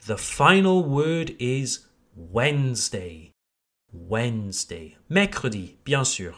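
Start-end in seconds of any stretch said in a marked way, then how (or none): 2.1-5.0 slow, careful model of Wednesday with silent d; Wednesday in two syllables, falling; correct pronunciation. Shown as a demonstration. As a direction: say Wednesday as "WENZ-day". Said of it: "essential to pronounce correctly".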